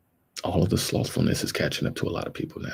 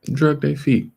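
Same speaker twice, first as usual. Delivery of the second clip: loud and pitched up; emphatic; 8 semitones higher, 4 dB louder than usual. Real, not acted. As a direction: say it with force. Repeats phrase, first take loud.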